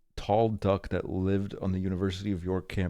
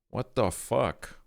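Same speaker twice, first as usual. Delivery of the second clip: stunned disbelief; 4 semitones higher, slower than usual. Real, not acted. Reacting to euthanized horse.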